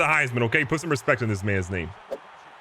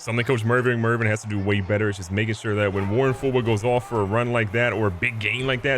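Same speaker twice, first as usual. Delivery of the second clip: ordinary delivery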